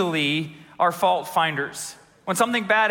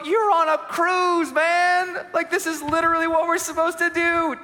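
exasperated inner voice; 10 semitones higher than usual. Retold or imitated. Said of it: "And I thought to myself".

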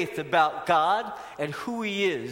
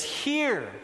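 emphatic hear; 7 semitones higher than usual. Acted. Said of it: "he says".

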